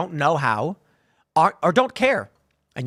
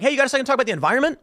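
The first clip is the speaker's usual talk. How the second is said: rushed, higher, chirpy canvasser spiel; mock canvasser pitch; 6 semitones higher, much faster than usual. Mocking how canvassers stop people: fast, high sales patter. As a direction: rattle it off like a bored street fundraiser.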